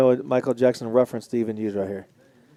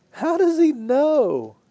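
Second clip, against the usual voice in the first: higher, slow, drawn-out disbelief; stunned disbelief; 11 semitones higher, much slower than usual. Real, not acted. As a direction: stretch it out, totally baffled. Second, bigger repeat of the question.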